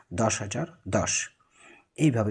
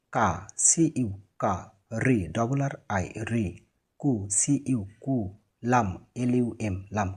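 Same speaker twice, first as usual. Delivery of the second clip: syllable by syllable; 2 semitones lower, slower than usual. Shown as a demonstration. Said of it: "syllable ভেঙে ভেঙে পড়লে".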